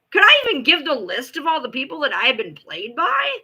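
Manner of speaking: ordinary talk, nothing marked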